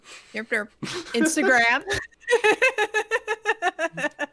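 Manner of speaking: long high laughing fit; laughing through the handle; helpless giggle. Real, not acted. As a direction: break into giggles. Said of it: "You infected me".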